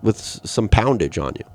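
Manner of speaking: ordinary talk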